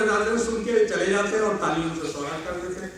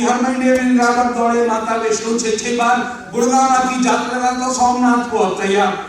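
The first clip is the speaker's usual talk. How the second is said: high, loud rhythmic poem recital; projected stage poetry; declaimed verse; 5 semitones higher, 8 dB louder than usual. Acted. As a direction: belt out the stanza, dropping on the refrain.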